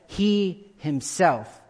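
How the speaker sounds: "He himself" slow and weighty, "he" stressed. Shown as a demonstration. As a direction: drag out "He himself" and push the "he". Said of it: "the he there is emphatic".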